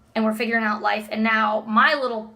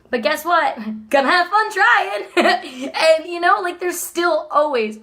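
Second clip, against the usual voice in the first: higher, louder, laughing at her own joke; 6 semitones higher, 5 dB louder than usual. Real, not acted. A wife joking about trying for babies, laughing. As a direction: giggle through the punchline.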